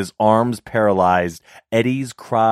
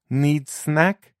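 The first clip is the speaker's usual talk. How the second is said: put-on girlish broken English; 8 semitones higher than usual. Girl offers a snack.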